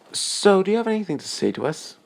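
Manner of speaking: high, pointed motherly question; a mother demanding an explanation; mother confronting her daughter and guest, pointed and high; stern mother questioning. Acted.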